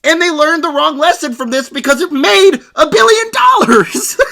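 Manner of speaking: loud laughing disbelief; exasperated laughing outburst; laughing shout. Real, not acted.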